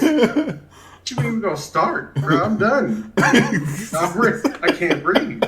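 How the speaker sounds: high, laughing mock complaint; retold winded exasperation; exhausted protest. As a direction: plead that you are done, half laughing. Acts out exhausted protest to trainer.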